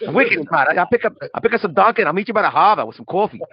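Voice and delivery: put-on quick, jokey Boston accent riff; mock Boston accent. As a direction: rattle it off in parody Bostonian accent. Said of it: "with that accent".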